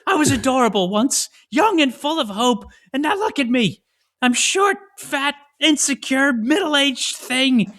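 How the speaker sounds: high, loud dramatic meltdown, slowing on the last words; Nathan Lane yelling. Retold or imitated. Drag star pointing at his partner, yelling high and loud. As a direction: wail it like an aging diva in full tantrum. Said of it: "He's yelling".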